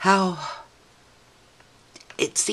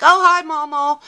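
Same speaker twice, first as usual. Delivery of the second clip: put-on high and loud, breezy child greeting; offhand kid voice; 11 semitones higher, 9 dB louder than usual. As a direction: toss it off like a busy six-year-old. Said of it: "And he's like".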